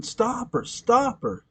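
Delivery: high-pitched cry without the volume; mild retold shriek; Flora's mock scream. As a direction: imitate screaming, keep it contained. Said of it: "Flora's screaming".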